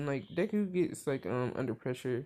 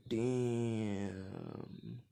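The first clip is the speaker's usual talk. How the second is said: stunned reaction. Retold or imitated. Stunned reaction, long drawn word.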